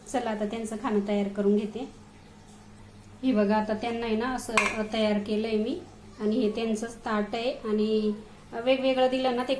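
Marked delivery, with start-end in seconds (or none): none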